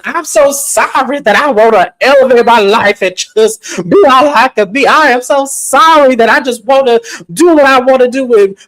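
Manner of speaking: high, loud, hammed-up wailing rant; mock dramatic outburst. Wife ranting at husband Glenn at dinner, hammed-up and high. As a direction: overact a tearful dinner-table meltdown. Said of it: "Oscar-winning speech".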